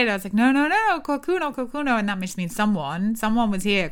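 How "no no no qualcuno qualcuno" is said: high, urgent repeated cries, re-enacted; retold frantic alarm. Guest warning Airbnb owners of intruder, frantic. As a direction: act out desperately alerting people in broken Italian.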